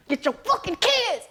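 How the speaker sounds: high, pushed fed-up scolding; exasperated scold. Retold or imitated. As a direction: let the annoyance boil over. Scolding the father.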